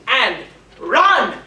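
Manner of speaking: loud, punched command; urging fiend. Acted. The fiend driving Lancelot to flee, loud command. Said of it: "says the fiend".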